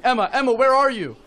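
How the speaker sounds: high, loud frantic calling of a name; frantic yelling. Retold or imitated. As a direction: shout for her across the river, panicking. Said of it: "I stand up and I'm yelling".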